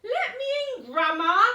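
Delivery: high, loud call through the door. Acted. Wolf calling through the door to Grandma, high and loud. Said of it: "he called".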